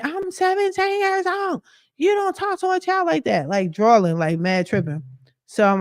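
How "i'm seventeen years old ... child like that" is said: high squealed imitation of an angry teenager; mock screaming teen. Mocking the girl who claims she's seventeen. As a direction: screech it like an outraged kid. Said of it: "she's screaming".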